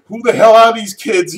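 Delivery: high, loud mock panic; freaking out. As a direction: imitate a man shocked by strange kids. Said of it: "he's freaking out".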